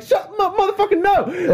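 mock high-pitched yell without extra volume; imitated outburst. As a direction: squeal it in a comic falsetto, mimicking someone panicking. Retells a friend's TikTok outburst.

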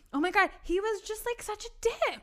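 put-on high gossipy girl voice. Shown as a demonstration. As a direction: gush like a gossip. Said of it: "it's like".

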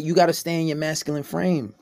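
normal talking voice

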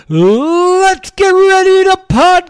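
booming, stretched-out high announcer call; ring announcer. Acted. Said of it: "as they say in the squared circle".

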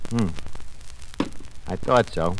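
normal talking voice

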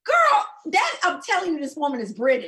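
high, loud disbelieving interjection; indignant high-pitched protest; exasperated insistence. Real, not acted. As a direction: cut in loudly, voice shooting up. Argument over the woman's accent.

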